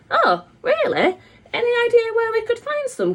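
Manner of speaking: over-sweet smile in the voice; sugary politeness. A narrator's reading. Mum pressing the rude manager with sugary politeness. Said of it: "smiling even more".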